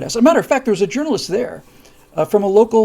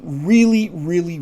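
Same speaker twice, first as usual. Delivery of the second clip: ordinary delivery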